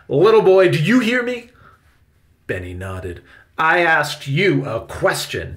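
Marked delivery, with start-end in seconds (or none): 0.1-1.5 very loud, high scolding demand; commanding. A narrator's reading. Victoria scolds the little boy, loud and commanding. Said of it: "Victoria commanded". 3.6-5.5 slow, loud, high demand. A narrator's reading. Victoria demands Benny's answer, slow and insistent. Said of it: "she demanded".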